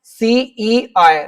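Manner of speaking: सीईआई drawn out very slowly, falling at the end; stretched out. Shown as a demonstration. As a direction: spell सीईआई slowly for the class. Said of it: "ऐसे खींच के बोल रहे हैं आप".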